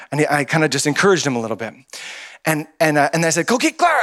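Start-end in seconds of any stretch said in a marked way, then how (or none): 3.5-4.0 high-pitched holler; mock yell. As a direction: call it out in a playful yell. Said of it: "And I yelled at Clara".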